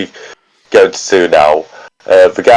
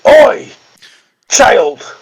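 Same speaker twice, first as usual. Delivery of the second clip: guard shout; 12 semitones higher than usual. Acted.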